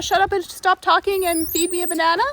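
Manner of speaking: high, loud monkey voice put on; cheeky monkey demanding a banana; voicing the monkeys. Vlogger voicing a monkey's demand, high and loud.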